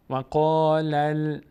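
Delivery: loud, high, very slow و قال ال; joined recitation. Shown as a demonstration. Teacher showing the finished join, و قال ال loud and drawn out. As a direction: chant و قال ال as one long linked sound. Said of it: "این لام میچسبه به".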